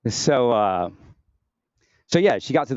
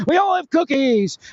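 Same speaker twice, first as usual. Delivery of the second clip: high, loud, drawn-out mock voice; hammed-up slow, high jeering; mocking taunt; 5 dB louder, slower than usual. Mocking voices between bursts of laughter.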